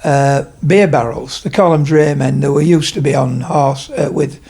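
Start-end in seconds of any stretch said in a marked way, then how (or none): none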